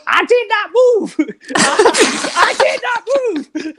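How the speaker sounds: John Drummond impression. Teller mimics sprinter ranting in pub toilets, high and slow. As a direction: stretch every word like a proud sprinter showing off. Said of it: "and just started going".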